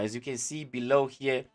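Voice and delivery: ordinary talk, nothing marked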